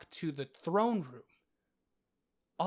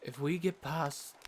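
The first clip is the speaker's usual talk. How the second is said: ordinary delivery, nothing marked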